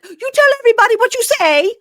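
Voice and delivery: high, loud shriek; imitating her yelling at him; mock hysterical yell. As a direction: screech the demand. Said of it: "when she starts just like".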